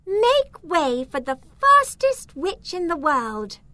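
high, loud boastful announcement; proud shout. A narrator's reading. Boastful witch to the onlookers at the race start, shouted. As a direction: shout it out to clear the crowd. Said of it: "She shouted".

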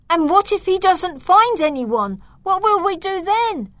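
put-on high, loud panicked child; panicky. Milo panicking that Dad finds no one, high and frightened. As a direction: voice jumping up in alarm. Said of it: "in a panicky voice".

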